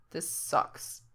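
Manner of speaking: low, muffled unvoiced mutter. Frank complains into Gerard's shoulder, a low mutter. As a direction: grumble it into his shoulder. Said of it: "Frank mutters against Gerard's shoulder".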